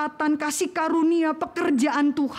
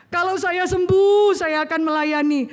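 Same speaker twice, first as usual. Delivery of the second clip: desperate vow; 5 semitones higher, 5 dB louder than usual. Retold or imitated. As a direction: beg God loudly, bargaining. Bargaining prayer when cornered by God.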